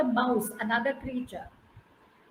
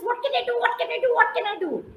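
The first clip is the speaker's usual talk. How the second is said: much higher, keen squirrel asking again and again; eager squirrel; 9 semitones higher, faster than usual. Acted. Squirrel to trapped friends, high and eager. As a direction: pipe up, eager to help. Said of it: "And he says".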